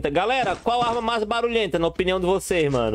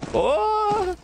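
startled curse; 12 semitones higher than usual. Real, not acted. Sudden outburst at death.

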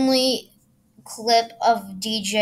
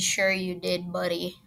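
"Sure you did" muttered softer; sarcastic mutter; 4 semitones lower, 8 dB quieter than usual. Real, not acted. Repeating the sarcastic retort.

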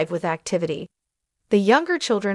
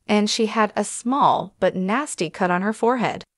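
ordinary delivery

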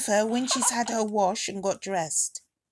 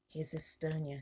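soft whisper so as not to wake the sleeping doll; 4 semitones lower, 9 dB quieter than usual. Real, not acted. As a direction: breathe the name, unvoiced and hushed. Introducing doll while others sleep.

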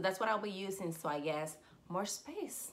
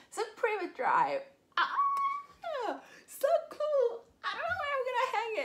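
high squeal of joy, fast; very high, loud excited squeal; squealing with delight; 6 dB louder than usual. Real, not acted. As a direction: gush over it like a fan. Squealing over new sign.

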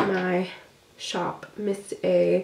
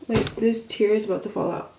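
ordinary talk

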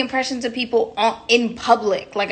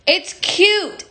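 high pop star voice with falling end; a lighter pop diva coo; 8 semitones higher than usual. Retold or imitated. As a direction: repeat the coo, settling down. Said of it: "my [name] impression".

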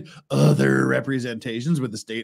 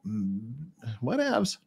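ordinary talk, nothing marked

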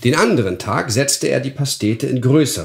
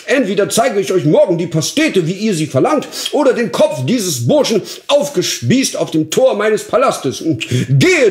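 much higher, louder raging vow; furious oath; 10 semitones higher, 5 dB louder than usual. Acted. Duke swears before his guest, furious. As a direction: swear it in full fury. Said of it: "geriet der Herrscher in Frankistan in Wut".

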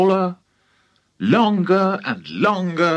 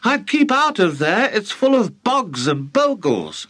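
calling up; 6 semitones higher than usual. Acted. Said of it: "George called out".

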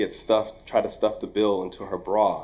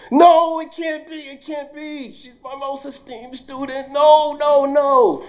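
very high, loud, slow shocked cry; shocked disbelief; 7 dB louder than usual. Retold or imitated. Teacher learning his star student is the thief, loud high shock. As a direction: cry out in stunned denial.

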